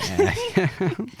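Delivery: squealing laugh; high giggle. Real, not acted.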